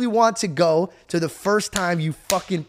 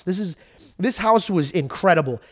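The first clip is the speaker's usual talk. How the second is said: normal talking voice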